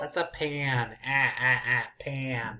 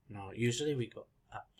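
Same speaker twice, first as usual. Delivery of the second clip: ordinary talk, nothing marked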